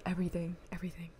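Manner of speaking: dropping to a whisper; hushed, teasing whisper. Acted. Teasing whisper, he hears everything.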